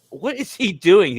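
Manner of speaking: loud, high, slow disbelief; what on earth is he up to; baffled. Retold or imitated. Friends spot him pacing on the ship deck, loud baffled disbelief.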